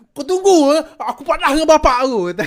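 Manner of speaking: put-on shouted, high crying kid's threat; angry child threat. Schoolboy who lost the fight shouts at the speaker, loud and high. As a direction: yell it like a beaten schoolboy running to his dad.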